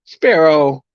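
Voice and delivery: loud scolding call to a pet; calling out sharply. Real, not acted. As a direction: call the bird's name to hush it. Scolding a pet bird mid-stream.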